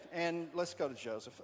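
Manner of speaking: usual speaking voice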